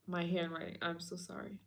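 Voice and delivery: ordinary talk, nothing marked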